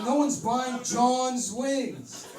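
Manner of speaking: high, loud, slow whine; slow loud grumble; complaining John. Acted. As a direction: moan about the wings. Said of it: "He's like".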